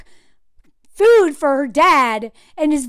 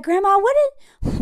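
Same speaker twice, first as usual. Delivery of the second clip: high, slow tentative question; lightly voiced granddaughter, pitched up; imitated granddaughter; 7 semitones higher than usual. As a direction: ask Grandma slowly in a higher voice. Acts granddaughter asking her grandma.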